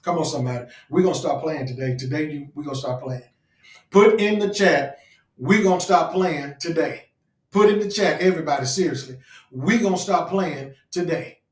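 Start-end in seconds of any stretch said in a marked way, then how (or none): 3.9-11.4 loud, slow, punched command; rally the congregation to repeat it; higher, forceful preaching with deliberate words; rallying preacher. Real, not acted. Rallies the chat.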